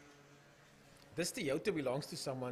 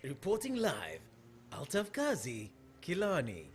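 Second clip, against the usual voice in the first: imitated live reporter sign-off; swooping, falling reporter cadence; Altaf Kazi impression; 2 semitones higher, slower than usual. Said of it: "Why do they speak like that".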